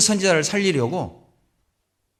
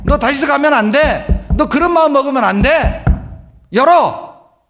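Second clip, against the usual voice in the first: a pleading God raising his voice to Jonah; God's voice shouting to stubborn Jonah; God calling out; 9 semitones higher, 8 dB louder than usual. Acted.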